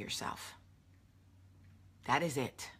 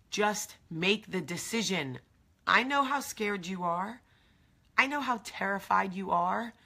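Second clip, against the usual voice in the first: usual speaking voice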